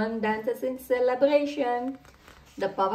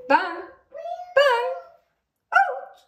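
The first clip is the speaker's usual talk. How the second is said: cries out; 6 dB louder, slower than usual. A narrator's reading. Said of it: "cries Daddy Pig".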